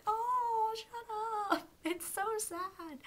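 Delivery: overwhelmed whine at a sad scene; teary fangirl wail. Retold or imitated. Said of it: "and I was like".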